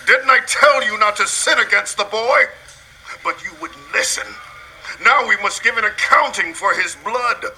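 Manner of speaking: high, loud scolding; angry reproach. Acted. Reuben blames brothers for the boy's blood, angry. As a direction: throw the blame back at them.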